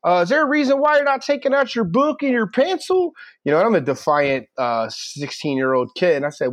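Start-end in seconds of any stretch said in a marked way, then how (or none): 0.1-3.1 very high-pitched mock teacher; imitated English teacher. Teacher questions a defiant new student, high mock reprimand. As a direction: confront the pupil in a put-on high voice. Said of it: "and he goes".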